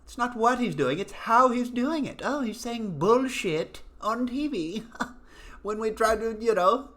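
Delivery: high, prissy mocking voice; mock outraged pundit. Mocking pundits outraged that Trump swears on TV, high prissy voice. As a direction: ham up the pearl-clutching pundit.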